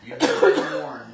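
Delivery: loud, slow, laughing warning; mock warning. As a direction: boom out the warning playfully.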